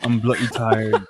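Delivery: slow, laughing mock of the tired friend; laughing imitation. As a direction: repeat it through laughter. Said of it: "He goes".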